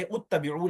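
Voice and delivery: उत्तबिऊ opening on "ut"; initial short u. Shown as a demonstration. Teacher rules out a misreading, उत्तबिऊ with initial "u". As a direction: say उत्तबिऊ with a "u" as first vowel. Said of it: "नहीं कहेंगे".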